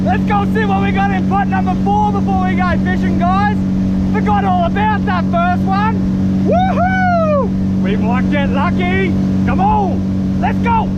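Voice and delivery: high-pitched eager chatter; excited. Real, not acted. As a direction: pump everyone up. Excited heading to pot four.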